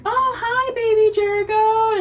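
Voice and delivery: put-on high sing-song hello to a baby; baby talk. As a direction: coo at the doll as if it's real. Nanny plays along with doll.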